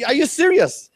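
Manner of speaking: high, stunned "Are you serious?"; incredulous. Retold or imitated. Professor to pizza-ordering student, incredulous and high. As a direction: pitch it up in disbelief. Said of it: "And I'm like".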